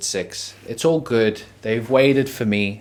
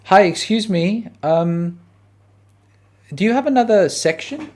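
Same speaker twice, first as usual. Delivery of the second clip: higher, sweet polite asking; polite customer voice; 8 semitones higher than usual. Retold or imitated. Comedian asks cafe staff for another section, higher polite voice. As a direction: put on your nicest customer voice.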